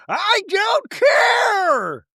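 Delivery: very high, very slow crying yell; hammed-up tearful outburst; wailing Meredith impression. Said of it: "And then Meredith going".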